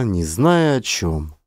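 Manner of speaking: ordinary delivery, nothing marked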